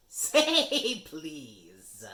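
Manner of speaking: high, loud, drawn-out taunt; teasing poltergeist. Acted. Peeves teases Filch, high and loud. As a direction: make him beg.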